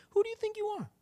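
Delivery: mock-offended, voice lifted high; rude challenge. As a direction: answer rudeness with a high-pitched huff. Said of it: "talking at you all rude".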